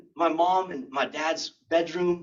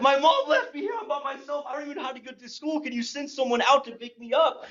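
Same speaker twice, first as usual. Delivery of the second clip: high-pitched, hurried mock plea; frantic child, hammed-up; 9 semitones higher, faster than usual. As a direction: babble in panic to an emergency operator. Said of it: "Slow down".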